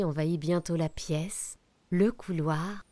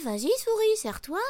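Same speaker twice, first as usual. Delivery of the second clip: high cookie voice; 13 semitones higher, 3 dB louder, faster than usual. Acted. Gingerbread man offers finger.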